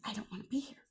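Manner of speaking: a retold whispered aside. Girl telling the football coach, whispered. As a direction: breathe it out under your breath.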